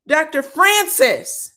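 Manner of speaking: loud, drawn-out, high call of the name; emphatic exclamation. Real, not acted. Recapper hailing the doctor's takedown, emphatically exclaiming. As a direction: savor the name at full voice.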